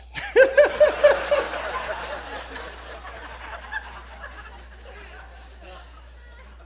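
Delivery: long high breathy laugh; big laugh. Real, not acted. Preacher cracking up at his own punchline, long laugh. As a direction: burst out laughing at the joke.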